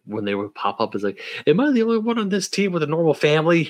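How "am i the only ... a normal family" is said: higher, faster, put-upon whine; exasperated character. Retold or imitated. Podcaster voicing a show character's complaint, higher exasperated whine. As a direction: play a character fed up with everyone's family drama.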